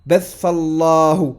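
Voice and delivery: Batha Allahu with the lam of Allahu held long; model reading with long vowel. Shown as a demonstration. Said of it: "pour montrer qu'il y a une prolongation".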